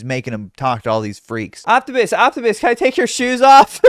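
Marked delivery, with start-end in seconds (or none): 1.7-3.8 loud, high calling; mock calling out. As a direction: shout to get the robot's attention. Mock fan calling robot.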